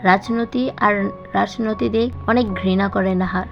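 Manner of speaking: ordinary delivery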